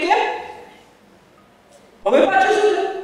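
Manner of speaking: high, fast, fed-up question; exasperated Kabir. Retold or imitated. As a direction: throw up your hands and ask.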